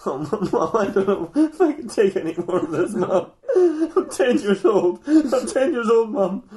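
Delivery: high, drawn-out wail through laughter; mock tearful breakdown. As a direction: plead like a sobbing child, hammed up. [name] breaks down before his mum.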